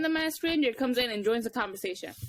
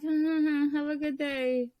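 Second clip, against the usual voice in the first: stretched-out, higher sing-song farewell; fake polite; 4 semitones higher, much slower than usual. Sending off the customer who spoiled it, fake polite. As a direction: drag it out with mock courtesy.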